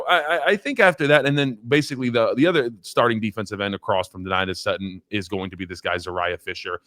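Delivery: ordinary talk, nothing marked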